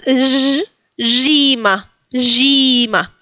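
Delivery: sustained zh, then Gima as ZHEE-ma; French-like j. Shown as a demonstration. Teacher applying the French sound, long zh. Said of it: "Es como en francés".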